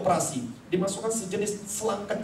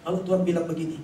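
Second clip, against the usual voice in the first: usual speaking voice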